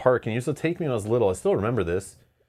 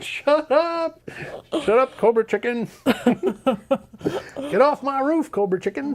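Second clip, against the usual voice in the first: silly clown; 14 semitones higher than usual. Retold or imitated. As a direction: shriek at the goose on the roof. Yelling at geese on roof.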